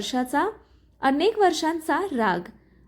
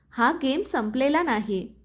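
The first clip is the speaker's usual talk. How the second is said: ordinary delivery, nothing marked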